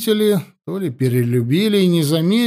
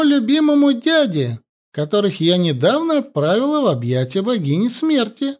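boastful; 7 semitones higher than usual. A narrator's reading. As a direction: brag like a mad queen. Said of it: "похваставшись".

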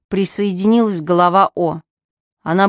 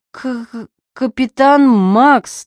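stammering with cold; 6 semitones higher, 5 dB louder, slower than usual. A narrator's reading. Frozen man stammers report.

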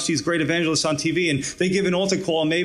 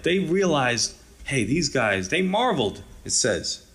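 normal talking voice

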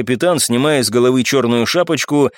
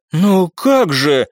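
drawn-out, higher protest; exclaiming; 4 semitones higher, much slower than usual. A narrator's reading. Brunette objecting to the refusal, exclaiming. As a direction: cry out in dismay. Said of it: "воскликнула брюнетка".

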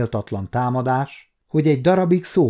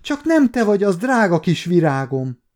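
raised, high-pitched cry of recognition; astonished greeting to a beloved child; joyful disbelief; 6 semitones higher, 3 dB louder than usual. A narrator's reading. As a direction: exclaim fondly in amazement. Old servant recognises lost girl.

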